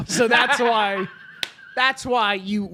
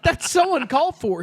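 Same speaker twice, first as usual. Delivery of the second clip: high, louder mock indignation; offended protest; 9 semitones higher, 6 dB louder than usual. Sketch bystander objecting to an insult, offended protest. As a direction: play the wounded bystander, pitched up.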